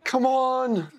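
high, exasperated shout; desperate high-pitched urging; urgent yell. Real, not acted. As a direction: yell it at the screen. Yelling at film character to act.

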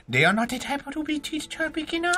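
understated mock-Chinese trainer voice; mock Chinese accent. Dog explains techniques to a beginner, mock Chinese accent.

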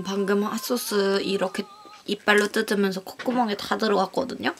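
ordinary talk

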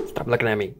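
normal talking voice

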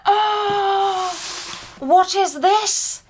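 high, thrilled gasp of a question; excited discovery. Retold or imitated. To viewers, acting out her first sight of the box, thrilled. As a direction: squeal over the new box. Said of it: "I was like".

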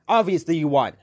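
ordinary delivery

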